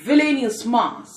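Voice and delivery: plain reading voice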